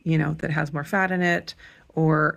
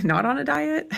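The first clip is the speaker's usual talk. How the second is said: laughing; 9 semitones higher than usual. Real, not acted. Laughing about the diet.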